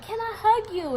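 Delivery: high-pitched coaxing question; imitated consoling manager. Manager offering crying employee a hug, high and coaxing. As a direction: mimic a sympathetic plea in a high voice.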